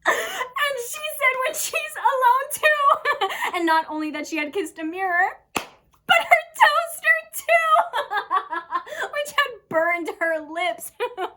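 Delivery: shrieking high and loud between bursts of laughing; hysterical laughter. Acted. Character reveals the lady kissed her toaster, laughing hysterically. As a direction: crack up completely on the punchline. Acted monologue, cracking up at story.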